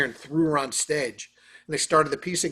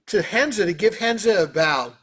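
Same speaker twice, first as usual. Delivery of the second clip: ordinary talk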